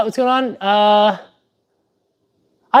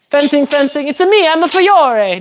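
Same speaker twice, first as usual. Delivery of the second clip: high, hammed-up Italian accent; Fiore; 6 semitones higher than usual. Streamer making fun as a fencing master, mock Italian accent. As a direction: introduce yourself in a silly Italian accent. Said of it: "hears me doing an Italian accent".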